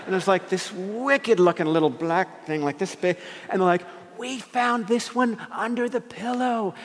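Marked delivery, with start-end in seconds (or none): 4.2-6.7 put-on high, girlish sing-out; put-on perky teen voice; imitated teenage daughter. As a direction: mimic a girl cheerfully sharing a horror. Daughter shows spider from pillow.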